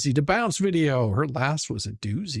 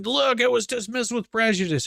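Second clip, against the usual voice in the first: mock gloating; 9 semitones higher than usual. Mocking opponents' celebration.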